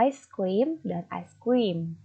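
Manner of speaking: Ice cream said very slowly, word by word; slow careful demo. Shown as a demonstration. Teacher comparing two similar words for students, very slow. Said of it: "memiliki pelafalan yang hampir mirip".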